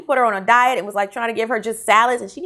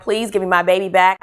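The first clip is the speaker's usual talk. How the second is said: ordinary talk, nothing marked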